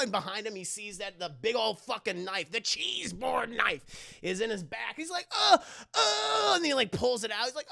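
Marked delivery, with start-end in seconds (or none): 5.3-6.5 high imitated whimper of pain; mock pained groan. Imitates stabbed father groaning.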